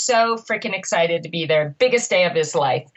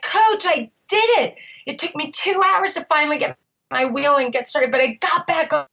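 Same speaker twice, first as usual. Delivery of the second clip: higher-pitched retold triumph; imitated ecstasy; 5 semitones higher than usual. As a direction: voice an overjoyed racer phoning the coach. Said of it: "He is ecstatic".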